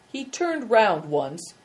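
usual speaking voice

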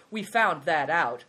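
loud, slow proud statement; measured, emphatic pride. A narrator's reading. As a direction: claim the discovery proudly.

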